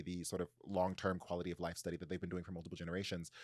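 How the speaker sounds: normal talking voice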